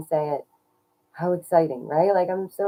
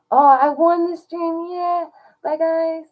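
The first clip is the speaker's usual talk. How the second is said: much higher and louder, slow sing-song; giddy cheer; 12 semitones higher, 7 dB louder, slower than usual. Real, not acted. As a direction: streamer signs off to chat with a squeal. Cheerful sign-off.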